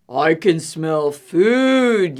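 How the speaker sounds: low, slow, big bear rumble. Acted. Hungry bear sniffing out the picnic, low and slow. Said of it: "yelled a voice nearby".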